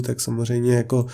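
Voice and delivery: ordinary talk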